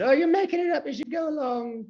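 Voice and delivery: high-pitched comic heckle; Monty Python heckler. Acted. Somebody in the film crowd heckling Jesus, high mock call. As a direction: put on a high voice, as if calling from a crowd. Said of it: "then somebody else said".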